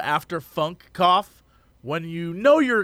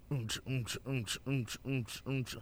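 club beat imitation; 6 semitones lower, 18 dB quieter, slower than usual.

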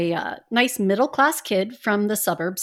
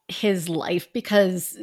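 ordinary talk

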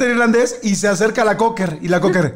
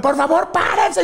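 high pleading; 10 semitones higher than usual. Retold or imitated. Begging dad to stop.

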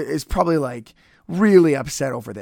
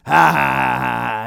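mock villain laugh, fading away; evil laugh; 4 semitones lower, 3 dB louder, slower than usual. Podcaster imitating Fuji's laugh, mock evil laugh. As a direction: imitate a manager's evil cackle, trailing off. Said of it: "and his evil laugh".

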